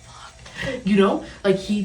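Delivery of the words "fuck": barely audible mouthed fuck; mouthed curse. Retold or imitated. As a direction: imitate him cursing under his breath. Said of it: "like, visibly going".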